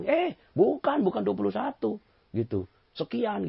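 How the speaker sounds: very high, slow, insistent denial; a high-pitched stubborn contrarian; squeaky contrarian. Retold or imitated. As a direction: squeal the wrong answer stubbornly.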